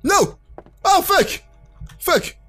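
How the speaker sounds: sudden high panicked swearing; real fright, blurt it out; startled cries. Real, not acted. Jump scare in horror game. (cris de peur)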